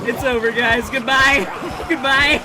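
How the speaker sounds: high, loud wailing goodbye; despairing shout. Real, not acted. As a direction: cry out in defeat. Character dies.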